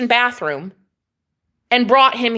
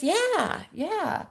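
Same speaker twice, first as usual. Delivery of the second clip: high, soft, unconvincing yeah; hesitant, much softer, falling agreement; iffy; 9 semitones higher, 7 dB quieter than usual. Retold or imitated. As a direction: imitate someone dodging the question quietly. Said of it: "Like, like, iffy".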